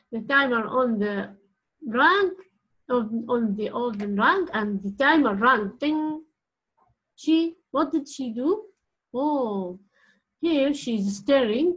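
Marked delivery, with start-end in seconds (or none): none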